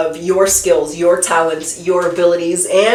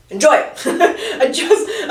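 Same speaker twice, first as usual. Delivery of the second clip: giggling through the words; breaking into giggles; laughing; 9 semitones higher than usual. Real, not acted. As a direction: laugh while you say it. Laughing at own frustration.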